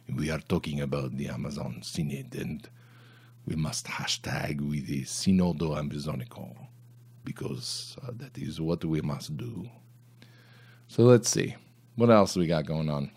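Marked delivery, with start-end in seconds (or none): 0.1-9.7 softer, mock-earnest parody voice; a hint of pompous official; mock solemn. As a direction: lightly send up the official tone. Mocking synod jargon.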